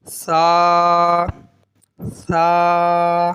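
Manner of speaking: "سا" as a thin th sound, vowel held; lisped Arabic tha in "سا"; thin, no whistle. Shown as a demonstration. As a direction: draw out "سا" without any whistling. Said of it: "باریک پڑھیں گے سا کو".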